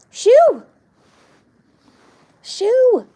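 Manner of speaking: loud high shout of shoo; sharp shooing shout. Real, not acted. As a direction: chase the dog off with a yell. Chasing the dog away.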